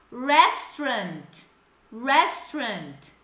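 very slow, drawn-out "Restaurant"; slow model word. Shown as a demonstration. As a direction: stretch out every syllable for learners to copy. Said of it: "People, repeat with me". ("Restaurant" bem devagar para repetir)

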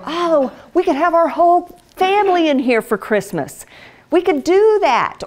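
lightly voiced daydreaming wife; wife imagining a Christmas gathering, excited; dreamy excitement. Pretending in dream homes.

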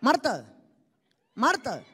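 husband calling Marta twice; a husband calling his wife's name from another room. Retold or imitated. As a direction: call out Marta, waiting for her to answer. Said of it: "el día que te hablan por tu nombre".

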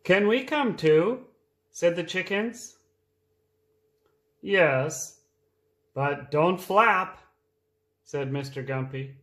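reading a picture book aloud; lively read-aloud for small children; storytime voice. A narrator's reading.

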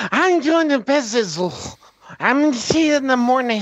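put-on drunken slurring. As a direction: slur it like someone drunk saying goodnight. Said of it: "barely anything that they're saying".

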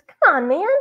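high, squeaky crook. A narrator's reading. Robber shrugs off his partner's worry, squeaky voice. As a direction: squeak it out, pitched well up. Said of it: "squeaky voice".

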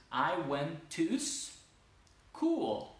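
"I went to school" as "toos... cool"; slow demo, S attached to "to"; S moved back. Shown as a demonstration. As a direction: end "to" on a long S, pause, then "cool". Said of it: "Puedo terminar la palabra anterior".

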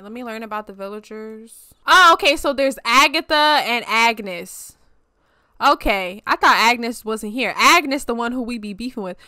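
usual speaking voice

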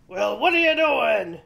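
loud troll demand. A narrator's reading. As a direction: bellow the question as a troll. Troll challenges middle goat.

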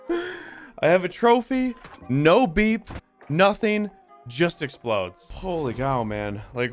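ordinary talk

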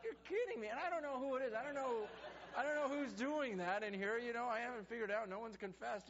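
high, loud, astonished exclaiming; amazed disbelief. Retold or imitated. Pastor retelling his reaction to the train sermon, loud excited disbelief. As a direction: burst out in delighted disbelief. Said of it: "And I'm like".

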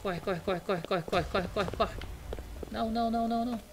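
high frantic shouts to run; panicked screaming. Real, not acted. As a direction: yell in real panic as it closes in. Fleeing the monster in game.

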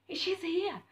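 high-pitched re-enacted disbelief; amazed. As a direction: exclaim it high after a gasp. Retold amazement at Brittany arriving.

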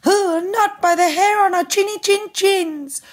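very high piglet squeal; two little pigs crying out. A narrator's reading. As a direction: squeak it out in alarm. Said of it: "cried the two little pigs".

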